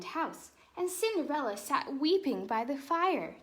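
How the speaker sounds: lively storybook read-aloud; animated picture-book narration; reading to children. Acted. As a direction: read it like bedtime story time for kids.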